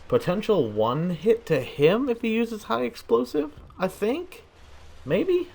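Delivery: very high, questioning squeak; doubtful guessing. Real, not acted. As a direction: voice climbs far up in uncertainty. Guessing aloud, unsure of damage.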